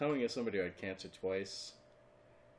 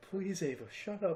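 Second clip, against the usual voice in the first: higher, begging the dog to hush; high pleading; 10 semitones higher than usual. Retold or imitated. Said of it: "I'm like".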